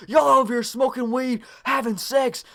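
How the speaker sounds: high-pitched ranting, imitating a mother; acted frantic mom tirade; freaking-out mom. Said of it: "she's freaking out".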